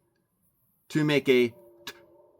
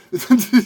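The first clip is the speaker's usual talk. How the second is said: real laugh; 10 semitones higher, 10 dB louder than usual. Real, not acted. Laughing at own joke.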